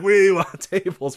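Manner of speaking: high, loud, stretched-out chant; mock crowd demand. Fans demanding someone go through a table, mock crowd yell. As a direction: laughingly imitate a whole arena clamouring.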